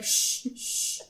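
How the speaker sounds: sharp unvoiced "shh", repeated; shushing. Audience members hushing noisy friends, shushing. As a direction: hush someone with hissed shushes. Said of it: "people around you are kinda like".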